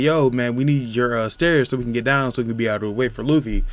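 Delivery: ordinary talk, nothing marked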